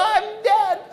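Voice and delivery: high-pitched mock despair; comic doom. Crazed jumper realizes his fate, high comic doom. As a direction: pitch it up high as the whooping collapses.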